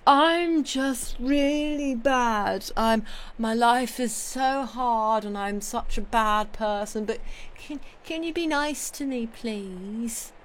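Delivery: slow, high self-pitying whine; whiny Sidney Carton. Retold or imitated. Streamer mocking Sidney Carton, slow high self-pitying whine. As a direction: plead pathetically, slowly. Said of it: "Sidney Carton doing his".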